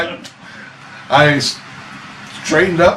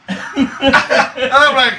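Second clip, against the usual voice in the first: laughing; 11 semitones higher, much slower than usual. Real, not acted. Laughing through retelling.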